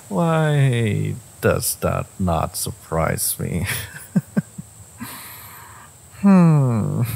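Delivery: high giggles around a slow, drawn-out question; teasing amusement breaking into laughter; amused chuckling. Acted. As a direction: chuckle knowingly at a lover. Amused by lover's confession.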